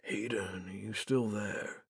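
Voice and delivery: barely audible, hesitant call; quiet. Acted. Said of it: "his voice so quiet I can barely hear him".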